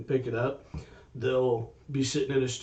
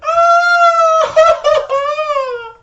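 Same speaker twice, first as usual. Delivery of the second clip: sudden high, loud shriek breaking into a laugh; mock scream; 14 dB louder than usual. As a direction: let out the panicked scream you held in. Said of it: "trying not to scream out loud, but in my head".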